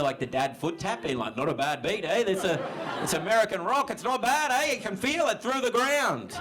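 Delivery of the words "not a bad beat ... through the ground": dad's grudging approval building to high excitement; mock dad getting carried away by the beat; imitating dads. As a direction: start casual, climb much higher. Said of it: "There's a lot of dads doing the".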